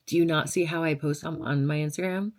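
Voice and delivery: usual speaking voice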